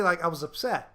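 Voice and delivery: ordinary delivery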